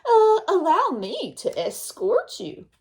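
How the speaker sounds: a wolf oozing fake courtesy, drawn out; sly wolf voice. Wolf voiced in a read-aloud story.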